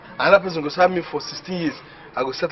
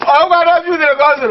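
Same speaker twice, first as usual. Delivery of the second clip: excited rush; 10 semitones higher, 5 dB louder, much faster than usual. Real, not acted. Excited babble about the money.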